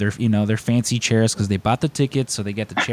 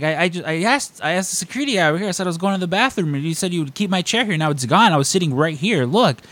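fast, high-pitched indignant rant; mock outraged tirade about the chair; retold yelling; 7 semitones higher, faster than usual. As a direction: rattle off the complaint pitched way up. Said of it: "I yelled at poor Melissa".

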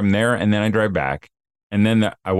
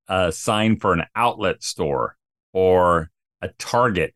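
normal talking voice

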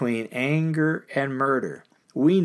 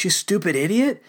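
higher, scornful jab of an insult; mock contempt; 7 semitones higher than usual. Preacher acting out what we are tempted to say, mock scorn. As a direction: sneer it like you mean the put-down. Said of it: "we're tempted to say those things".

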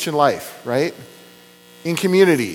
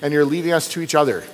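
lower, earnest prayer voice; closing prayer; 5 semitones lower than usual. Acted. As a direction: pastor praying to God to close the sermon. Pastor leads closing prayer.

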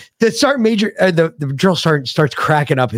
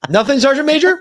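high innocent reply to a superior; nervous private answering; 11 semitones higher than usual. Retold or imitated. Private answering the sergeant major innocently. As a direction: play it meek and caught out. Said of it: "And I was like".

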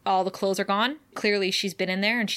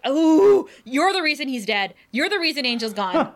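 raised, loud accusing rant; 6 semitones higher, 5 dB louder than usual. Real, not acted. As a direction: lay the blame with heated outrage. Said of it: "just rant about Xander".

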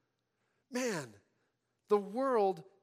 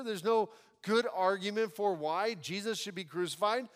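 ordinary talk, nothing marked

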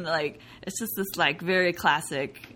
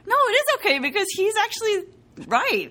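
insistent realization; 5 dB louder than usual. Retold or imitated. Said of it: "And then I was like".

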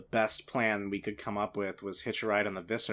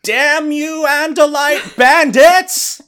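booming, slow cartoon villain shout; cartoonish fury; 18 dB louder, much slower than usual. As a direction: shake your fist and roar like a cartoon villain. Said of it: "very good Saturday morning cartoon villain voice".

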